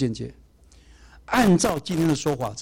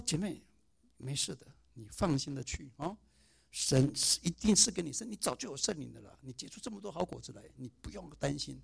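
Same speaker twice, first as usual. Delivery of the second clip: tender reassurance; 5 dB quieter than usual. Retold or imitated. Comforting dying sister in hospital.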